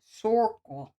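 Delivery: "Circle" with a New York "sɔɹkəl" vowel; a light New York accent on "Circle". Shown as a demonstration. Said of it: "in a New York accent".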